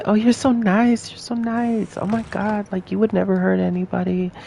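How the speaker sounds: gushing admirers. Acted. Fooled Hogwarts students praising the player, in a high gushing voice. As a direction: gush sweetly in a high imitation of fawning followers. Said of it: "They're gonna say".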